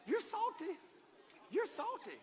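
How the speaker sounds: high, sing-song teasing, climbing then easing; the pastor showing the church how to tease a neighbor, high and playful. Shown as a demonstration. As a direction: shake it at them and tease. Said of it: "go like this and go".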